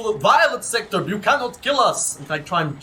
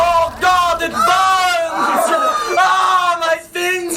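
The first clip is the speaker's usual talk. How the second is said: higher, louder cry of burning; pained yell; 11 semitones higher, 5 dB louder than usual. Acted. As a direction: yell as if scalded. Hands in hot plastic.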